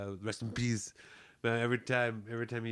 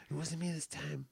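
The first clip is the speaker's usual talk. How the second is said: ordinary delivery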